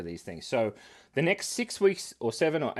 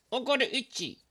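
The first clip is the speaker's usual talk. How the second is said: squeaky silly voice, way up high; a playful squeak up high; 12 semitones higher than usual. Pretend. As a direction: pitch it up like a cartoon.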